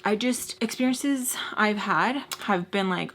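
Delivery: ordinary talk, nothing marked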